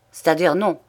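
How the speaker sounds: usual speaking voice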